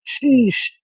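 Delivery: Sheesh. squeaked up high, stretched out; high mock sheesh. Streamer answering chat with a high, teasing Sheesh.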